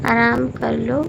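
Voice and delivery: ordinary talk, nothing marked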